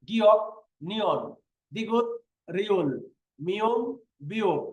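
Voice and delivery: letter names singly, a pause after each; one-by-one recitation. Shown as a demonstration. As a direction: recite like a roll call, each name set apart. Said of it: "চোদ্দটা ব্যঞ্জন বর্ণের নাম শোনেন". (একটা একটা করে থেমে নাম পড়া)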